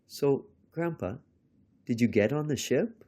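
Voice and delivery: put-on high child's voice, rising question; curious grandchild. A narrator's reading. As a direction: ask Grandpa high and expectantly. Grandchild asks Grandpa in story.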